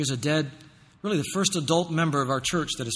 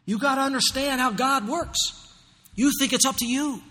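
usual speaking voice

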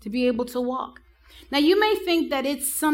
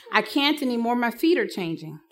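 usual speaking voice

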